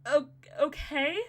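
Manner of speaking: stumbling, drawn-out okay, rising; hesitant okay. Retold or imitated. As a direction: stall on it, voice lifting doubtfully. Retold wary reply to housemate's suggestion.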